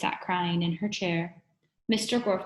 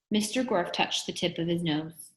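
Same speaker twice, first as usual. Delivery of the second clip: ordinary delivery, nothing marked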